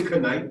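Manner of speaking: knife said as "kuh-NIGHT", with the k sounded; knife in two syllables, "kuh-night", as a question; echoed mispronunciation. Retold or imitated. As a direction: echo knife with an extra "kuh" syllable in front. Teacher echoes student's odd pronunciation.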